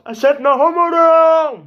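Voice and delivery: loud, high, drawn-out yell; shouting. Pretend. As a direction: yell it in protest. Shouting denial.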